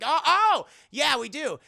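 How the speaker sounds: loud, high acted "oh"; exaggerated mock realization; sudden realization. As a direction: catch on with a burst. Character suddenly catches on.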